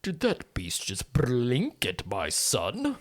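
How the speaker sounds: slow, affronted patriarch; indignant Edwin. Acted. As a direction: draw out the question in offended disbelief. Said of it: "And Edwin says".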